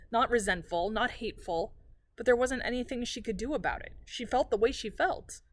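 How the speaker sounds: plain reading voice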